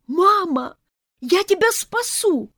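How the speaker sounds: high, loud, slow rescue shout; heroic cry. A narrator's reading. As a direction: yell to save mom. Son rushing to save mother.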